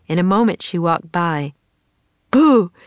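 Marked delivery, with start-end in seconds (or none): 2.3-2.7 loud, higher "Boo!" to spook; playful shout. Acted. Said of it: "Jack shouted".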